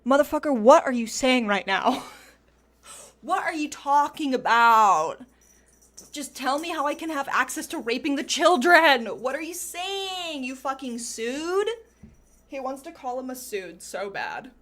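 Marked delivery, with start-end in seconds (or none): 0.1-11.7 loud, outraged yelling; mock furious shout. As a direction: shout it in disbelief at Epstein. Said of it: "Chomsky is like".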